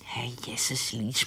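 slow, startled whisper; whispered protest. Acted. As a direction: hiss it under her breath. Secret talk about the past.

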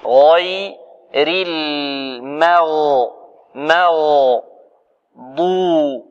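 غير المغ drawn out slowly; teacher spelling out sounds; letter-by-letter demo. Shown as a demonstration. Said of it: "demonstrates letters of المغضوب".

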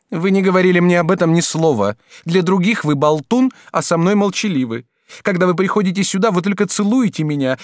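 lightly voiced sulking girl; reproachful girlfriend. A narrator's reading. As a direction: list every grievance against him. Gervaise reproaches her lover.

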